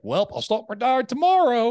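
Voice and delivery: high, loud mock give-in; caving in. Caving to friends about the diet, mock cheerful. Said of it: "you're just like".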